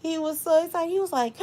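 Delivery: ordinary talk, nothing marked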